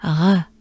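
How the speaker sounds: R as a breathy exhale, tongue down; just breathe out the R; French R. Shown as a demonstration. Teacher demonstrating the French R sound. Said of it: "goes something like this".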